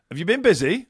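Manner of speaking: chirpy cab driver small talk; cab driver question. Retold or imitated. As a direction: ask it like a cheery cabbie. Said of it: "he gets in and he goes".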